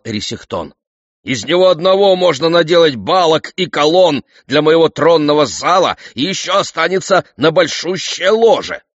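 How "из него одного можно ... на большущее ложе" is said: high, loud, gloating king; excited boasting. A narrator's reading. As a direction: crow over all the timber you'll get. King gloats over timber for palace.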